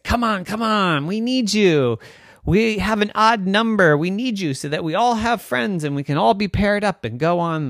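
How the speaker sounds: put-on high, wheedling kids' plea; pleading friends. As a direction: talk a reluctant friend into it.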